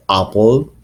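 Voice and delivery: apple in an Australian accent; Australian pronunciation. Shown as a demonstration. Teacher showing how Australians say apple, Australian vowel. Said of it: "Australian people say".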